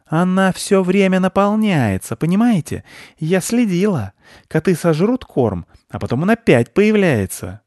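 a pushy, suspicious squirrel insisting. Acted. Squirrel accuses the children of hiding the nut.

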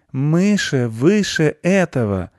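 stretched-out collective declaration; chorused. A narrator's reading. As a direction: intone it loftily like a chorus. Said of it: "сказали они хором".

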